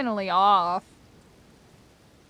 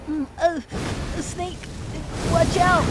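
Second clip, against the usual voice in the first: lightly voiced nerdy sidekick, a touch higher; a hint of a timid nerd warning Snake; mocking a nerdy sidekick to chat, slightly higher; Otacon impression; 4 semitones higher than usual. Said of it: "Doesn't he talk like".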